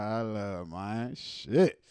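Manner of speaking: ordinary talk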